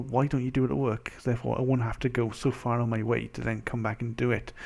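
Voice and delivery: ordinary talk, nothing marked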